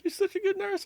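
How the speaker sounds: high, weepy mock sob of gratitude; imitating tearful gush. To the nurse during withdrawal, mock tearful high gush. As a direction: gush at the nurse like you're about to cry. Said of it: "And I start crying to her".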